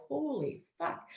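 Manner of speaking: hushed, drawn-out disbelief; a slow, soft exclamation under the breath; stunned aside. Retold or imitated. Driver reacting to a yelling officer, stunned and hushed.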